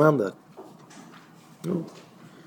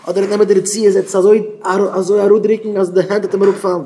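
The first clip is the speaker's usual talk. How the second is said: raised, loud insistent delivery; emphatic preaching; 5 semitones higher, 4 dB louder than usual. Real, not acted. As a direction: press the point hard. Emphatic account of straining piety. (הויך און נאכדרוקלעך)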